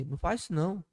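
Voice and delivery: quiet, gentle warning; soft inner voice. Retold or imitated. Preacher voicing the inner voice, softly warning. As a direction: hush it like a conscience. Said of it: "aquela voz interior fala assim".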